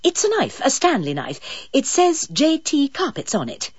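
high, prim phone voice; a touch of polite pretence; lightly posh caller voice. Acted. Carol acting the finder.

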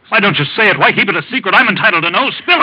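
loud, high, angry demands; demanding outburst. Acted. Sick husband demanding the truth from his doctor, angry. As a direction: force the doctor to tell him.

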